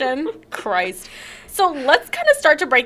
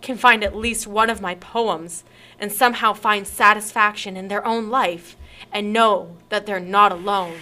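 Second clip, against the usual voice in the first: ordinary talk, nothing marked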